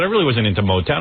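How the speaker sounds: usual speaking voice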